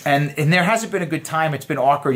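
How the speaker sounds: usual speaking voice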